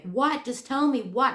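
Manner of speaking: just woken and panicking, demanding to know; alarmed demand. Retold or imitated. Woken by husband with news, alarmed demand. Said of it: "and I'm like".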